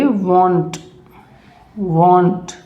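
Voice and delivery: want said as "woant"; won't voiced like want; saying won't. Shown as a demonstration. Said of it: "इस तरीके से बोलेगा".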